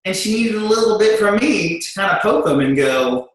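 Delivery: normal talking voice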